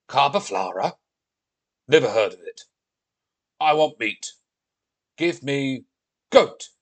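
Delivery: loud, blunt Viking demand. Acted. Viking refusing Olivia's favourite dish, loudly demanding. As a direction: build to an outburst on the last word.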